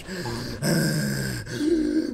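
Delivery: long, heaving mock breaths; exaggerated raging huffs; heavy angry breathing. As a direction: imitate someone fuming through heavy breaths. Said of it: "she's just breathing heavily".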